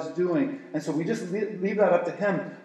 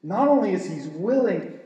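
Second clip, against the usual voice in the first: ordinary delivery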